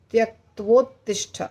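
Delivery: ordinary talk, nothing marked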